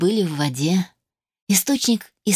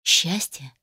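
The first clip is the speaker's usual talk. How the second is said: plain reading voice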